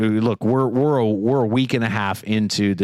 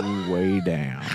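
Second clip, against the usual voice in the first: very slow, lower drawl; 5 semitones lower, 4 dB quieter, much slower than usual. Shown as a demonstration. Host trying the actor's trick, slowed way down. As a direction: drag it out at half speed, voice dropping. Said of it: "like, slowing it down".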